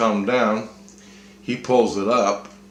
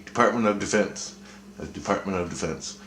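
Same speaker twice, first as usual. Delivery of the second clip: ordinary talk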